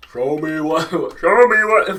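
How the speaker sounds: high, loud growled try; orc voice attempt. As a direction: fumble for the orc voice. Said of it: "how do you do the voice?".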